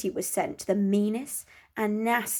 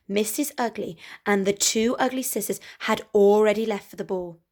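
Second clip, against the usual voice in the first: usual speaking voice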